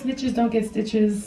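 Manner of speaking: ordinary delivery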